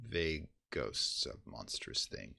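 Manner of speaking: ordinary delivery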